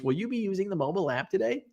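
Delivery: quick, even drive-thru robot prompt; lightly done automated greeting. Retold or imitated. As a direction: rattle it off like a canned recording. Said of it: "but it's an automated voice".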